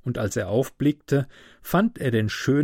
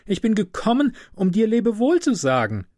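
high-pitched swallow's call; 10 semitones higher, 4 dB louder than usual. A narrator's reading. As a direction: call up in a much higher voice. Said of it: "rief sie". (mit hoher Stimme gerufen)

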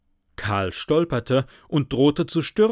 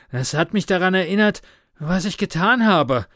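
quick, high, winded speech; breathless, louder and agitated; panting; 7 semitones higher, 5 dB louder than usual. A narrator's reading. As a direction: push it out between gasps. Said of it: "keuchte Karl".

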